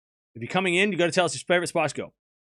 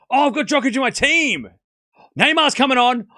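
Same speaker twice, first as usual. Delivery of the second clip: high, loud excited cry; excited outburst; 9 semitones higher, 7 dB louder than usual. Real, not acted. Streamer tells chat Neymar's coming on, excited shout. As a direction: shout the news out, thrilled.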